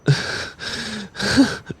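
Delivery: high, relieved, giddy laugh; a high giddy burst of laughter. Acted. Reacting to love confession returned.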